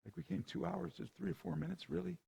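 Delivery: high, soft, disbelieving "really?"; a hushed, high-pitched can't-believe-it aside; incredulous. Retold or imitated. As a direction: quietly ask it in mock disbelief. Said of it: "And I'm going like".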